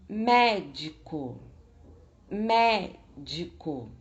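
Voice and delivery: médico slowly, MÉ loud and drawn out; stress on first syllable. Shown as a demonstration. Teacher showing the tonic syllable, médico stressed on MÉ. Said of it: "A sílaba tônica é mé".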